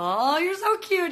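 high, loud gushing squeal; excited cooing. Real, not acted. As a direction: gush over how cute it is. Cooing over a viewer.

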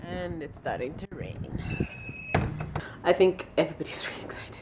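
fast excited whisper. Real, not acted. Whispering to viewers about the rain, fast and hushed. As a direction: hush it out quickly.

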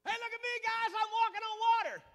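high, boastful mock brag; mock show-off. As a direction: show off to the guys, hammed up. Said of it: "That's not what he did".

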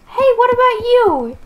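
high, loud hail; calling out. Pretend. To the next stranger, a high loud call. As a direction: call across to him.

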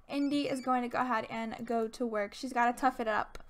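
normal talking voice

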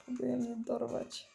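normal talking voice

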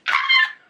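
squealing high and loud; high excited shriek. Real, not acted. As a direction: let out a delighted squeal. Reacting to the kiss.

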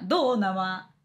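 usual speaking voice

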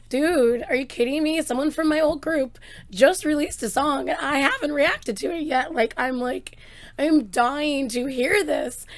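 can't wait to hear it; excited. Real, not acted.

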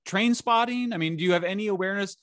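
high and incredulous, like you can't believe anyone could think that. Real, not acted. Incredulous outburst at the ideologues.

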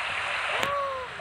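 high, soft gasp of delight; soft excited gasp. Acted. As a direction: catch your breath at the sight. Gasp at second deer.

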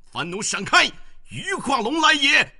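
very high, loud shout; loud battle cry. A narrator's reading. General charging into the camp, shouting. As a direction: yell the enemy aside. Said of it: "大叫一声".

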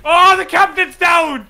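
loud, slow shout of alarm; man down shout. Real, not acted. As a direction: yell that the captain fell. Captain killed in game.

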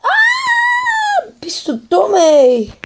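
very high shriek then scolding; shriek, then snap at him; raging gamer. Real, not acted. Gamer rage at enemy.

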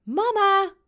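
put-on high, loud child's shout. A narrator's reading. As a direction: call out harder, pitched high. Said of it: "roept Robin iets harder".

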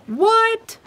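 squeaky high startled what; mock shock. Quilt shop host to viewers, mock surprise. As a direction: feign amazement at the deal.

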